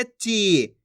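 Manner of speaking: ची drawn out on the vowel; long ee in ची. Shown as a demonstration. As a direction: hold the ई of ची. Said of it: "खींच खींच के पढ़ना है". (ची की ई खींचकर)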